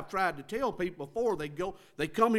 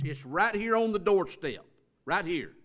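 normal talking voice

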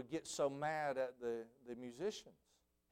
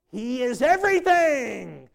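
much higher and louder, slow and stretched; soaring proclamation; 11 semitones higher, 16 dB louder, slower than usual. Retold or imitated. Conductor exalting Beethoven before the audience, soaring cry. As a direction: lift the whole hall on everything. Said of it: "But Beethoven".

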